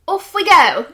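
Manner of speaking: loud, high mock-British sing-out; British accent. Shown as a demonstration. Showing how the show opened, in a British accent. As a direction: belt it out in a hammy British accent. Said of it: "Or [name] in the British accent".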